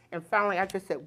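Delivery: ordinary talk, nothing marked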